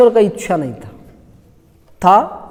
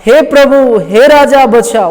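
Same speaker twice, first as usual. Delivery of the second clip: loud, raised plea read aloud; crying out; 4 semitones higher, 7 dB louder than usual. Acted. Preacher reads a woman's appeal to the king, crying out. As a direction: call out to the king for rescue. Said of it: "पुकार के उससे कहा".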